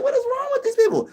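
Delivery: high mock falsetto of baffled people; mock shocked voice. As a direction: squeak it like a shocked onlooker. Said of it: "They be like".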